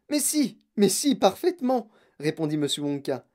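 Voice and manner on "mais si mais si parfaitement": high, chirped confirmation; emphatic, excited yes; eager insistence. A narrator's reading. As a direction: insist gleefully, high-pitched. Wonka eagerly contradicts Joe.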